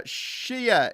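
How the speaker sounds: "Shia" drawn out slowly in a southern accent; southern English accent. Shown as a demonstration. Host trying the word in southern accent. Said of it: "in my southern accent".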